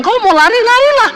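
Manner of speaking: wife calling out to her husband from the bathroom; calling back. Retold or imitated. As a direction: shout your answer back, offhand.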